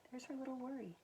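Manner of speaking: quiet aside. Real, not acted. Pointing at picture aside.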